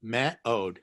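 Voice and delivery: Me'od said as me-od, two clear syllables; careful spelling. Shown as a demonstration. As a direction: split Me'od into me and od. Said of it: "M E O D".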